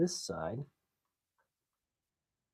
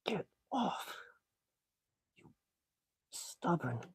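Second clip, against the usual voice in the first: straining; 7 semitones higher, 4 dB quieter, slower than usual. Real, not acted. Prying a stuck clip.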